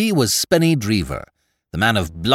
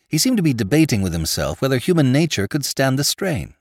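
ordinary delivery

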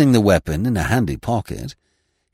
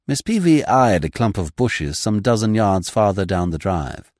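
usual speaking voice

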